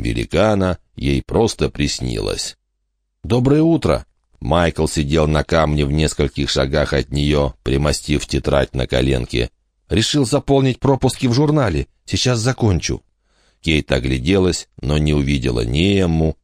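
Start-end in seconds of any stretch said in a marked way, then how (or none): 3.2-4.1 put-on boy's voice. Michael greets Kate. 9.9-13.0 put-on higher, lighter boy voice; a casual schoolboy, busy writing; boy's voice. Michael explains his notes.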